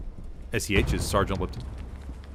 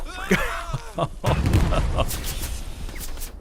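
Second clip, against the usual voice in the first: laughing; 9 semitones higher than usual. Real, not acted. Laughs after the death.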